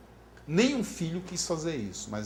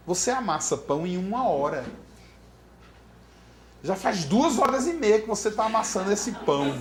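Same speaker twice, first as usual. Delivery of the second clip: louder, higher-pitched retold complaint; exasperated husband; 5 semitones higher, 5 dB louder than usual. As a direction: imitate a husband's emphatic reproach. Marido cobra a mulher, impaciente. (marido indignado, imitado)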